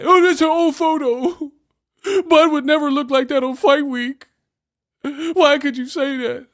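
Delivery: high, loud mock fan voice with a laugh; squeaky parody of a fan; mocking whiny fan. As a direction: whine it like an offended fan. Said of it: "why did you even need to say that".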